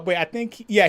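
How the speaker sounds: ordinary delivery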